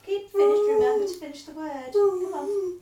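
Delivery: loud, high, urgent coaxing; eager encouragement. Real, not acted. Mother urging her son to finish the word, loud and high. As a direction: push him on, voice breaking with effort.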